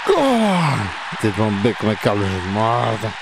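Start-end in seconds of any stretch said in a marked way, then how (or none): 0.1-0.9 real joyful goal yell; goal celebration. Real, not acted. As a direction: erupt as the ball goes in. Scoring a goal in the game.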